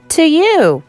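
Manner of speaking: ordinary delivery, nothing marked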